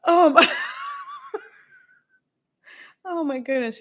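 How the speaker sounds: laughing through the words; cracking up, can barely talk. Real, not acted. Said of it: "you guys are cracking me up".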